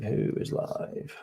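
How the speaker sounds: same question, whispered slowly to himself. Real, not acted. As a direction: mutter it under your breath, slowly. Repeats question under breath.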